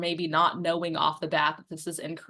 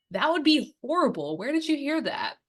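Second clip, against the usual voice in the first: higher, concerned and kind; sympathetic reply; 8 semitones higher than usual. Shown as a demonstration. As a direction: sound truly sorry and curious. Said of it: "and the answer is".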